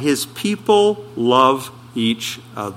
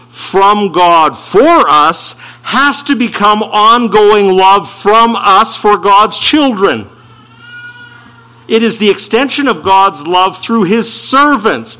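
louder, higher, driving sermon voice; preaching at full force; 5 semitones higher, 5 dB louder than usual. Acted. Preacher exhorts his church, raised and forceful. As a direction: press every phrase on the congregation.

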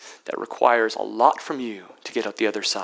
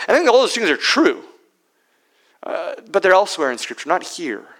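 loud, high, fast preaching; full-force preaching; 8 semitones higher, 7 dB louder, faster than usual. Acted. Preacher rebutting sentimental readings to the church, full-force preaching. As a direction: preach it out with rising fervour.

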